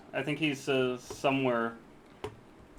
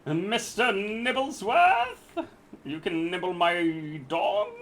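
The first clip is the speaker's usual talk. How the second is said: silly character voice; 6 semitones higher, slower than usual. Acted. Voicing squirrel character name.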